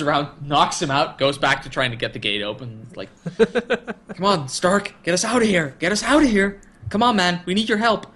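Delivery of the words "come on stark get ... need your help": higher-pitched imitated call for help; urgent mock plea. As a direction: plead for rescue, acted out. Acts urgent plea to Stark.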